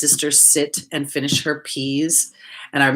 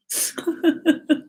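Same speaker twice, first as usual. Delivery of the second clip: high laugh; 14 semitones higher than usual. Real, not acted. Reacting to viewer comment.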